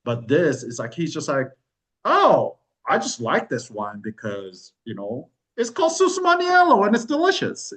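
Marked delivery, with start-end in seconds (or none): 2.0-7.7 imitated owner gushing, jumping high and loud; breezy enthusiasm. Bar owner talking up a favorite wine, breezy enthusiasm. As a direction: sell it lightly, swooping way up.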